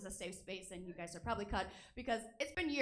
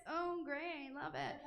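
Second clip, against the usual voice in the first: mock-pleased, slightly raised pitch; sarcastic delight; 3 semitones higher, much slower than usual. Single comic on getting dick pics, sarcastic delight. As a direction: feign mild enthusiasm. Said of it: "No, I don't really like it".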